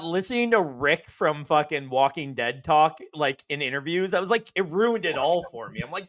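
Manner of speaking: ordinary talk, nothing marked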